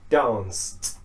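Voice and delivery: "danced" ending in a voiceless t. Shown as a demonstration. Teacher modelling C verbs, T ending. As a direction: say "danced" as one syllable, "dahnst". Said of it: "C ending".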